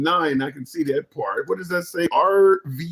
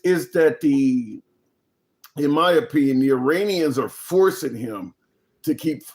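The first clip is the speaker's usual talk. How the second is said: ordinary talk, nothing marked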